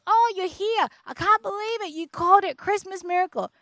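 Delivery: high, excited exclaiming; delighted disbelief. Retold or imitated. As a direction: imitate a doctor thrilled to see a patient. Retold cardiologist's delighted greeting.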